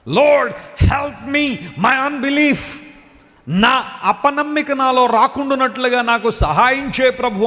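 crying out. Retold or imitated. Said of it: "గట్టిగా అరిచాడు అనమాట".

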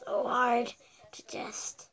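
unvoiced, slow strained whisper. Real, not acted. Straining, breathless under effort.

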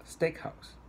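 STEAKhouse, STEAK pitched high; first-part stress. Shown as a demonstration. Teacher modelling a compound noun, high stress on STEAK in "steakhouse". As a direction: lift STEAK high in "steakhouse", then drop.

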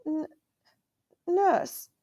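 put-on a frightened child stammering; faltering. A narrator's reading. Boy stammering the name to his angry uncle, frightened. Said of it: "faltered Caspian".

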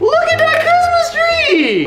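high, slow, thrilled squeal; excited. Real, not acted. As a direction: gasp it out with delight. Unwrapping a surprise candy.

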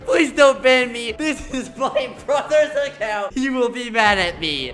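mock pleading as the caught player. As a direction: beg like a scared kid. Voicing the player's pleas.

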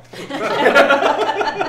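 loud, high burst of laughter; big laugh. Real, not acted. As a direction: burst out laughing at your own joke. Laughs at his own punchline.

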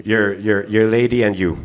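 usual speaking voice

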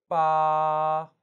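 long level 빠, loud; first tone. Shown as a demonstration. Teacher putting A after B, 빠 sustained level. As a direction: hold 빠 on one even pitch. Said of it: "일성으로".

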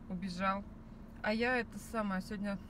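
ordinary talk, nothing marked

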